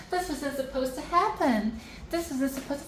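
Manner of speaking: a lightly hammed-up flustered queen; mock panic. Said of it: "she's like".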